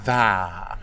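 "the" with a bright, open, held vowel; brighter vowel. Shown as a demonstration. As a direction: stretch "the" into an open "thaa". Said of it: "has to be brighter than it would ordinarily".

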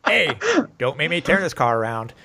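ordinary talk

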